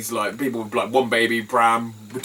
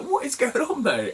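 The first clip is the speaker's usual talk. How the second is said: much higher baffled question; mock baffled; 11 semitones higher than usual. Telling viewers his reaction to the lady, much higher mock baffled. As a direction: pitch it up in disbelief. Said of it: "I'm like".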